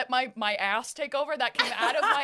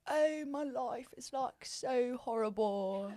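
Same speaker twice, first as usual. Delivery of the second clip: slow, soft moping; mock miserable; 8 dB quieter, slower than usual. Imitating miserable English guys, mock moping. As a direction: drag it out softly, wallowing. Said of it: "I just love how miserable they are".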